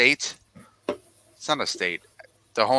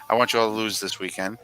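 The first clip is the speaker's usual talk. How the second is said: ordinary delivery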